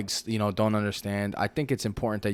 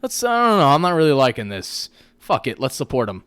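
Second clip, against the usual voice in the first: mock rallying call; 3 semitones higher, 6 dB louder than usual. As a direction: rouse the crowd, a bit hammed-up. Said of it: "the generation now".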